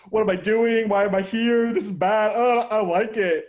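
high, loud panicky rambling; panicked. Retold or imitated. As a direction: spiral into stage-fright panic. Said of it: "where you're like".

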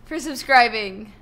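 high, loud thank-you shout; excited shout. Real, not acted. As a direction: yell out the thanks with glee. Subscriber alert shout.

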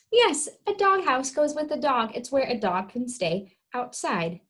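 ordinary talk, nothing marked

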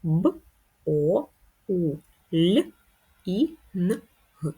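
Б, О, У, Л, І, Н, Г said as separate sounds with pauses; teacher slowly sounding out each sound; sounding out letter by letter. Shown as a demonstration. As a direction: spell боулінг out sound by sound. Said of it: "вимовимо його по звуках".

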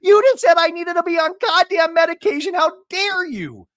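high, loud indignant outburst; Shannon outraged. Retold or imitated. Shannon furious at Tamara about medication, high outrage. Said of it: "Shannon's like".

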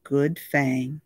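usual speaking voice

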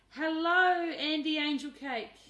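very loud, bright greeting shout; hearty yell. A narrator's reading. Aunt greeting Andy at the gate, very loud hearty yell. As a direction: belt out the hello.

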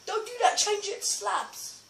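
high-pitched protest; urgent objection. Real, not acted. Player stopping a friend mid-build, urgent objection.

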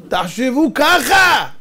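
slow, high, emphatic shout; loud exhortation. Real, not acted. Preacher urging the audience to think this way, shouting. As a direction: stretch it out and raise the voice.

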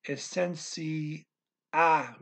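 "essenci-" slowly, then a separate stressed "ah"; slow syllables, landing firmly on "ah"; broken-down pronunciation. Shown as a demonstration. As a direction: sound out "essenci-, ah" in parts. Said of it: "Okay, you say".

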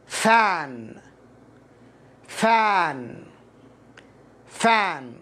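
"فان" said alone with a long open vowel; pronunciation model. Shown as a demonstration. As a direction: model "فان" deliberately, falling tone. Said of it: "هذه الكلمة تنطق". (نطق "فان" بألف ممدودة)

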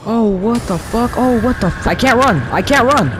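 high-pitched panicky repeats; rising panic, pitch way up; panicked. Real, not acted. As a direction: cry out helplessly while being chased. Chased by monster, cannot run.

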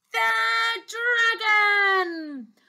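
high, long drawn-out announcement; slow, high, thrilled exclamation; dramatic reveal. A narrator's reading. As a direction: draw out the big reveal excitedly. Dramatic reveal after suspense.

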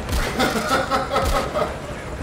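breathy laugh. Real, not acted. Laughing at friend's yell.

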